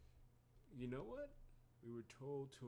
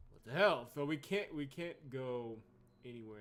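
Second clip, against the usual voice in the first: exasperated; 3 semitones higher, 13 dB louder than usual. Real, not acted. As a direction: blurt it out loudly, baffled and fed up. Blocked doors frustrate the player.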